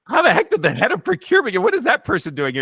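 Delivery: imitating the boss panicking in his head; mock freaking out. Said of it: "he's kind of freaking out a little bit".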